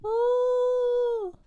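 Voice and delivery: long, very high held "oh"; dismayed squeal. Real, not acted. Streamer mortified by a wrong emoji, high squeal. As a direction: squeal it out on one high note.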